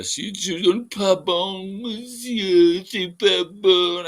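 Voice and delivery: high, loud mock babble; mocking imitation. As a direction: mimic her outburst in a high, silly voice. Mimics the woman's outburst.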